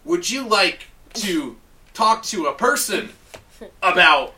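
slow, lightly robotic menu voice; mock robot voice. Phone robot repeating menu options, mock robotic. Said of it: "it's like".

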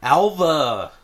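put-on Nicolas Cage's surfer accent; imitated surfer-dude accent; bad California surfer accent. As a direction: mimic a Cage-style California drawl. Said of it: "can you do his, his accent?".

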